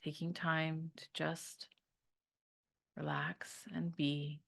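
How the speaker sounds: calm meditation voice with long pauses; guided meditation. Real, not acted. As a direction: guide them to unwind, leaving room to breathe.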